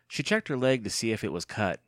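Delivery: ordinary delivery, nothing marked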